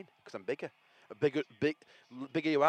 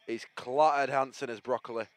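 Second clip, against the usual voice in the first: ordinary delivery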